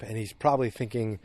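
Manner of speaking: normal talking voice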